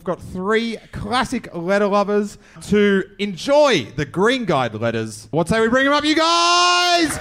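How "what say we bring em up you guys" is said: rising into a high, stretched shout; hype-man call to the crowd; rallying the crowd. Real, not acted. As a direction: whip up the audience, climbing to a yell. Rallying the crowd to welcome guests.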